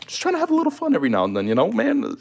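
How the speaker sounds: high, fast, whiny plea; comic self-pitying whine. Real, not acted. Streamer excusing his bit of fun, high comic whine. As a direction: rush it out in a high mock whimper.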